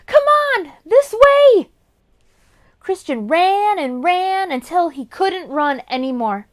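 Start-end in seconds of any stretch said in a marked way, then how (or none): 0.0-1.7 much higher, loud scream. Acted. Boy fleeing, screaming to the goblin to follow. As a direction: scream it while running. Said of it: "while screaming to the goblin".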